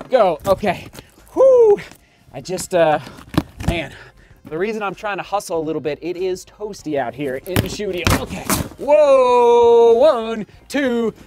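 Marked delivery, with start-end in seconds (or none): 1.3-1.8 a high, loud breath out; loud, high exhale; loud whew. Real, not acted. Catching breath in hot studio. 8.8-11.1 loud whoa, then a very slow, drawn-out count; slow, building unboxing countdown; excited countdown. Real, not acted.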